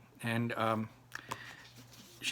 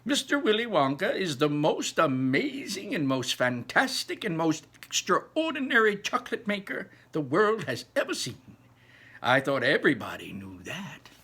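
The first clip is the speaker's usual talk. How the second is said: climbing higher and higher in wonder; Grandpa Joe excited; 6 semitones higher, 5 dB louder than usual. Acted. Grandpa Joe telling Charlie about Wonka, rising excitement. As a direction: build the praise to a high peak.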